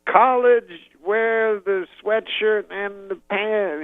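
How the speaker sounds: high, slow Cosby advice; imitating Bill Cosby; Cosby impression. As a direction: drag it out slowly like Cosby. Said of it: "Bill Cosby goes".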